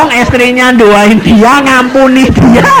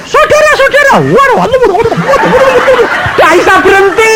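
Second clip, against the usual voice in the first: much higher, laughing wow wow babble; tongues in a speech; 13 semitones higher than usual. Retold or imitated. Danu leading the ceremony speech, babbling high and laughing. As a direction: sound like a speech taken over by tongues.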